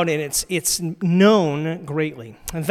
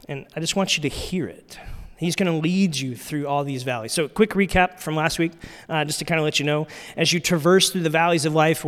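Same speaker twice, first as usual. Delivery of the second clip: normal talking voice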